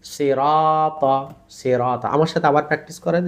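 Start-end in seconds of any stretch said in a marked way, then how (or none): 0.0-2.1 সিরাতা with thick consonants and a long রা; heavy, stretched recitation. Shown as a demonstration. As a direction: hold রা in সিরাতা, keep every letter heavy. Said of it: "তিনটা অক্ষরই মোটা ধ্বনি".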